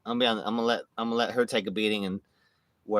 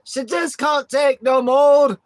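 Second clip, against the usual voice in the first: slow, high, shouted Scottish wail; Scottish accent; 14 semitones higher, 10 dB louder, slower than usual. Pretend. Tag partner pleading from the sidelines, shouted Scottish accent. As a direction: holler it out in hammed-up Scottish. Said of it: "I love Scottish accent".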